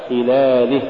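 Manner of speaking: خلاله with a reduced-weight khaa and clean i; lighter khaa. Shown as a demonstration. Tajweed teacher giving the example, lighter khaa. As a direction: keep the i of خلاله clear. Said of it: "والصواب".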